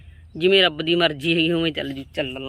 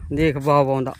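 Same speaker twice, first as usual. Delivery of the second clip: usual speaking voice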